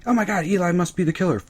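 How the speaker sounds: high, fast fake gasp; rushed mock audience. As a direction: gabble the tired guess. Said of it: "Episode nine or ten".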